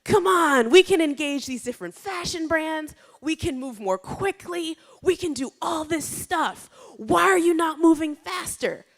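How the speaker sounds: loud, high-pitched impatient pleading; urgent, shouted pestering; pushy urging. Retold or imitated. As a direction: push them hard to get moving. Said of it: "I kept pushing Rebecca".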